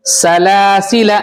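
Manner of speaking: drawn-out syllables, sa-la-si-la; slow model reading. Shown as a demonstration. Teacher reading सलासिला slowly for pupils. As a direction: read सलासिला slowly, every syllable apart. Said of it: "पढ़ो".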